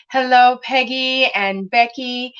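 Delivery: ordinary talk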